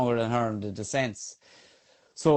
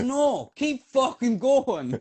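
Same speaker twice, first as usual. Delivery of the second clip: shouted reply; 12 semitones higher, much slower than usual. Retold or imitated. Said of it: "He says".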